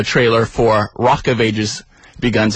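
ordinary delivery

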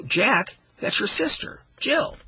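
"that's your sister, Jill" lifted high as a reminder; high-pitched reminder on "your sister, Jill"; amnesia reading. Shown as a demonstration. Host showing Jack has amnesia, high reminding emphasis. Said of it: "by the way that we emphasize".

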